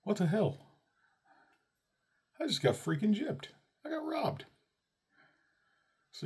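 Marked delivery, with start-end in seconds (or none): 0.0-4.4 high, loud comic outrage; indignant shock. Retold or imitated. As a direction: squawk like you've been robbed. Daughter runs off, father outraged.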